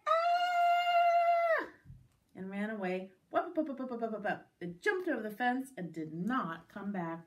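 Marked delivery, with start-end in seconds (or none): 0.1-1.7 very high loud squirrel scream; long piercing shriek of a frightened animal; yell. A narrator's reading. Squirrel fleeing a roaring girl, high scream. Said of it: "the squirrel yelled". 3.3-4.5 quick popping running noise; scampering sound effect. Acted. Rabbit running away, quick scampering noise.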